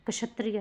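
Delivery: क्षत्रिय begun with "ksha"; corrected pronunciation. Shown as a demonstration. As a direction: say क्षत्रिय as "ka-shatriya". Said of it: "होगा".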